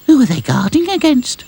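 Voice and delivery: very high doll voice; Lucy's voice. A narrator's reading. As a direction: ask like a curious little doll. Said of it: "said Lucy".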